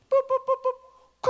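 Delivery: high beeping boops, phone ring mimic; high, loud mock dial tone; imitated ring tone. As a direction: beep it out like a phone ringing.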